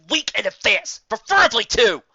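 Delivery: the anger finally breaks out; forced through teeth. Acted. Pony losing patience with Dad, forced angry outburst. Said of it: "forced out between quietly clenched teeth".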